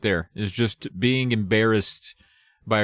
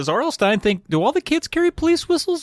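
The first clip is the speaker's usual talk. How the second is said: incredulous. Real, not acted. Mocking the author's logic.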